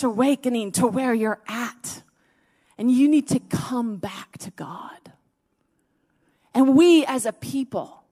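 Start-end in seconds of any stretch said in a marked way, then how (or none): 2.8-8.0 a breathy, tearful whisper. Real, not acted. Preacher begging the congregation to return, tearful whisper. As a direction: plead with them, voice near tears. Preacher pleading, near tears.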